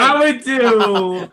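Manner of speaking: slow, laughing, loud stall. Real, not acted. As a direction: laugh through it slowly.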